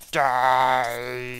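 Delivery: loud hammy battle cry; bad acting. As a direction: shout it out like a cheesy action hero. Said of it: "excuse my terrible acting".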